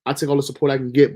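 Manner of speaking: ordinary talk, nothing marked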